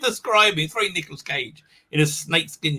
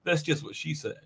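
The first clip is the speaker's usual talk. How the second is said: soft deadpan punchline; understated Nicolas Cage delivery, softer; 3 semitones lower, 12 dB quieter than usual. Retold or imitated. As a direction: drop it flat and soft like Cage. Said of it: "Cage said".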